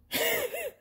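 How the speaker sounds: brief high-pitched laugh; quick burst of a laugh; short high laugh. Real, not acted. Blooper laugh.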